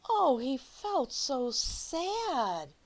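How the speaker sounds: usual speaking voice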